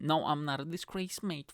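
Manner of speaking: usual speaking voice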